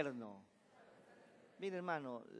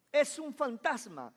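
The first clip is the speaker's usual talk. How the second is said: frightened cry; 7 semitones higher, 8 dB louder than usual. A narrator's reading. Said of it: "y de miedo se pusieron a gritar".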